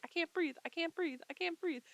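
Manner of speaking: mock panic, phrase by phrase; imitated hyperventilating panic. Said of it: "she starts hyperventilating".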